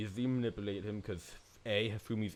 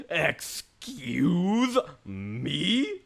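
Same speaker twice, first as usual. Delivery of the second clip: extremely slow, stretched, louder indignation; mock outrage; 5 semitones higher, 6 dB louder, much slower than usual. Real, not acted. Player shushed by the detective, hammed-up stretched outrage. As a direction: drag it out in theatrical outrage.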